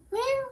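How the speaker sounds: squeaky imitated cat meow; high meow. Real, not acted. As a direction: mew like a kitten. Meowing at the cat.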